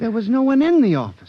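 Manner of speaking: high, loud and fast; heated protest. Acted. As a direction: fire it back at the boss. Clerk protests to his boss.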